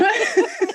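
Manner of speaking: high burst of laughter. Real, not acted. Remembering her poker-playing grandma, high laugh.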